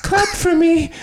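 squeaky pleading fairy; high fairy voice. Pretend. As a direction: beg high and thin.

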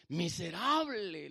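slow, weighty pronouncement of scripture; drawn-out rebuke. Acted. Preacher quotes Apocalipsis rebuking materialistic people, drawn-out rebuke. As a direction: stretch the word out slowly.

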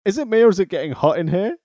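playful mock delivery of a wrestler's line; mock smooth line. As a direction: sell the corny line with a wink. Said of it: "I mean, what a line".

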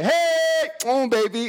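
high mock lovey-dovey coo; mock lover. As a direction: camp it up as a cooing boyfriend. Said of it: "in Johan's arms like".

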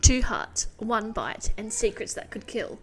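blurb read with a punched, loud Two; big dramatic book-trailer opening. Shown as a demonstration. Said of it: "I'm gonna start again".

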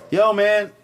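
high, loud bystander impression.

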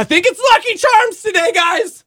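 high voice calling up to the factory; playful high call; high silly mock voice. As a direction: sing it up to the factory like a fan. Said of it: "just go".

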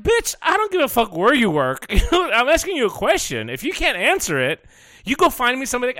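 high, loud exasperated rant at the clerk; retold angry rant. Telling off the airline clerk, loud and furious. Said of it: "And I'm like".

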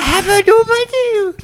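put-on high, slow old lady croon; granny voice. Saying nickname in her voice.

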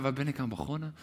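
softly, to oneself; quiet inner thought. Retold or imitated. Preacher voicing quiet private doubt, softly. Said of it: "Hij dacht niet stilletjes bij elkaar".

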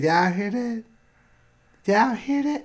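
high, teasing appeal to the audience; mocking to camera. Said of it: "looked at the camera and said".

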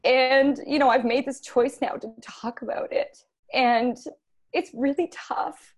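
high, tearful strain while holding it together; choked up, pushing through it with pauses; getting emotional. Real, not acted. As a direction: voice lifted high with real emotion. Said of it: "I'm gonna get emotional".

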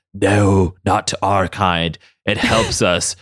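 low, slow villain voice; Magneto. Retold or imitated. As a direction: play a grave, deliberate old mastermind pleading his case. Voicing Magneto answering Kurt.

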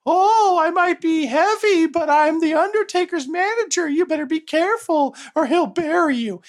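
very high-pitched wailing manager voice; Paul Bearer. Retold or imitated. Wrestling manager warns a heckler in character, high hammy wail. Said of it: "just been like".

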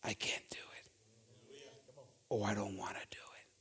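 hushed, unvoiced whisper of a reluctant man; soft whispered excuse; whispered reluctance. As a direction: whisper it like you're backing away from the job. Moses' reluctance voiced by preacher.

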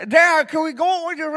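put-on teen daughter with gauze in her mouth, slurred and loud; mouth full of gauze. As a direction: mumble it through a mouthful of gauze after wisdom teeth. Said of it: "So she's like".

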